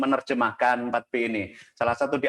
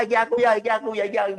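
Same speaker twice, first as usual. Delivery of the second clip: put-on excited child; 8 semitones higher, faster than usual. Voices his excited young son.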